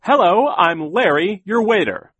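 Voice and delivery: high, loud waiter's greeting; chirpy waiter. A narrator's reading. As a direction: greet the table in a big, high voice. Waiter greets his diners.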